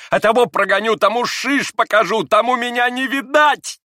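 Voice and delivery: high, loud frenzy building to a scream; frantic, raging high-pitched cries; hysterical shouting. A narrator's reading. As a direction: work yourself up into hysterics. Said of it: "Последние слова она истерически прокричала".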